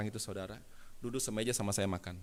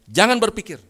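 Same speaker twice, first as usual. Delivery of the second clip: loud, high command; sudden forceful shout; shouted warning; 11 semitones higher, 16 dB louder than usual. Retold or imitated. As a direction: shout a stern prohibition at the crowd. Retold rebuke, shouted warning.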